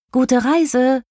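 high-pitched send-off; farewell call. A narrator's reading. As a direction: call it out as a goodbye. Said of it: "riefen alle".

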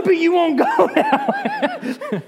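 laughing out the punchline; laughing through line. Real, not acted. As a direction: crack up mid-line. Balaam's deadpan reply.

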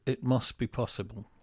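usual speaking voice

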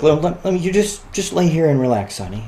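high, gentle coaxing to your wife; lightly sweet, talking her into resting; sweet offer. Retold or imitated. Husband offers to feed the baby.

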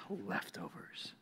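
slow, near-whispered disappointment; mock dismay. Child hearing parents' dinner plan, whispered mock dismay. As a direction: let it sink out in a slow whisper.

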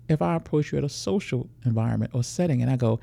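normal talking voice

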